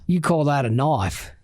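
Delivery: put-on Australian accent with knife said as noice; playful Australian impression; Paul Hogan Australian. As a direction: repeat the Aussie movie line. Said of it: "Little Paul Hogan for you".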